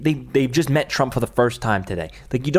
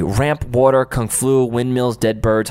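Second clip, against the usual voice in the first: ordinary talk, nothing marked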